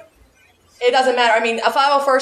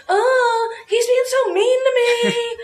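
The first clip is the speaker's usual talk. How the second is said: high, slow, whining mock complaint; whiny complaint; 12 semitones higher, much slower than usual. Member phoning Al to complain about someone, high whining mockery. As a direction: imitate a member whining down the phone, pitched way up.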